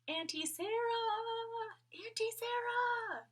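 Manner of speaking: calling into empty rooms, searching; a searching call, drawn long. A narrator's reading. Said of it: "Annabel and Bobby called".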